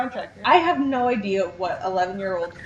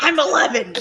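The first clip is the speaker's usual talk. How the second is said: put-on higher-pitched echo of a boy's protest; protesting Corey; 4 semitones higher, 4 dB louder than usual. Co-host echoing the Corey impression, higher mock protest. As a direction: copy the mock kid protest. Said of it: "And Corey's like".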